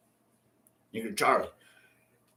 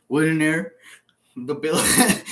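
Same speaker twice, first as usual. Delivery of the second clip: usual speaking voice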